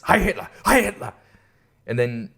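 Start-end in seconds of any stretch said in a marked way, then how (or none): 0.0-1.1 higher, falling hail of a crazed man; a crazed businessman chanting; mock ranting businessman. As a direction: bark it like a confused zealot. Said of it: "and then he says".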